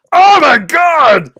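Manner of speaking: high shocked yell; shocked shout. Real, not acted. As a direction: cry out in shock. Host kicked from game.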